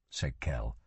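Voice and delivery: ordinary delivery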